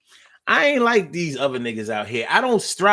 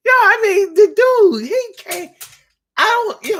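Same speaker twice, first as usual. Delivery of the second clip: flustered squeak; 4 dB louder than usual. Real, not acted. Flustered by Narciso's blogger offer.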